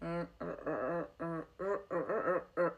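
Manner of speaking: repeated English R, tongue curled; R sound demo. Shown as a demonstration. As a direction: keep sounding R, R, R. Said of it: "and that's your R sound".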